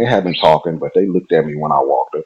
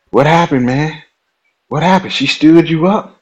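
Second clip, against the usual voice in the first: Herman teasing; 10 semitones higher than usual. Retold or imitated. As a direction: play Herman needling him. Herman teases him, retold.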